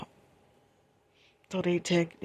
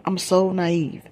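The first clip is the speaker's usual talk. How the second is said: ordinary talk, nothing marked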